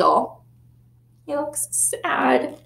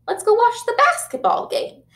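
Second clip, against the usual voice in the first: bright, higher, eager invitation; cheery mom; 12 semitones higher than usual. A narrator's reading. Mom trying to cheer up her sad son, upbeat and higher. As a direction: mom coaxing with a lift.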